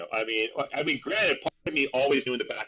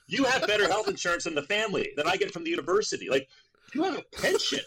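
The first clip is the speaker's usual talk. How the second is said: higher, quicker mock lecture; father lecturing son on the union, lightly mocked and high; lecturing dad; 8 semitones higher than usual. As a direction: explain it like an exasperated father. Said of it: "And he goes".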